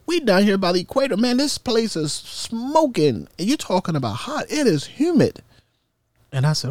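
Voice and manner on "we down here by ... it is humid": high, quick complaining voice; high mock shipmate. Shipmates grumbling about Guam heat, high and whiny. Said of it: "and they said".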